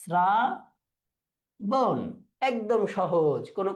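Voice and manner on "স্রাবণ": শ্রাবণ as "sraa... bon" with a long pause; dental s, split. Shown as a demonstration. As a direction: model শ্রাবণ very slowly, s not sh. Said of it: "দন্ত স".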